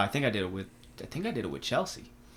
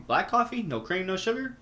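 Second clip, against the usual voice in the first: much higher, louder questioning; incredulous check; 9 semitones higher, 3 dB louder than usual. Retold or imitated. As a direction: pitch it way up in disbelief. Checking the coffee order.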